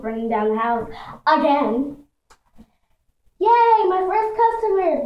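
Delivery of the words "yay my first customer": put-on high, loud little-kid cheer. A narrator's reading. Little sister welcomes her first salon customer, high excited cheer. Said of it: "Miss Brady cheered".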